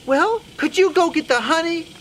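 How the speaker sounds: high, loud mouse request; insistent little mouse lady; pleading Sue Mouse. Acted. Sue Mouse asks in her voice.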